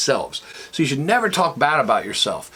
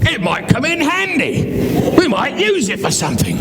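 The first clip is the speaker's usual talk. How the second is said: wife voice; 9 semitones higher than usual. Retold or imitated. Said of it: "the wife going".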